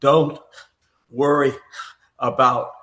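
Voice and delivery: deliberate quoted reassurance with big pauses; measured reassurance. Retold or imitated. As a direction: imitate a confident naturalist, spacing out each word. Voices Roosevelt reassuring his swimming aide.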